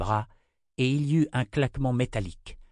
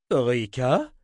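louder questioning call; calling across; 3 semitones higher, 5 dB louder than usual. A narrator's reading. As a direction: toss the name across the tent. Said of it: "lança Zimmer à l'autre bout".

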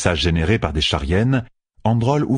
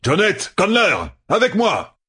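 much higher rallying call; 13 semitones higher than usual. A narrator's reading. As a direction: summon them across the fight. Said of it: "lança Androl".

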